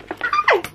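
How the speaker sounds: very high excited squeal; short piercing happy squeal; delighted squeal. Real, not acted. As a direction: shriek with fangirl joy. Squeals over loved book.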